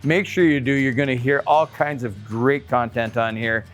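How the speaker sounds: usual speaking voice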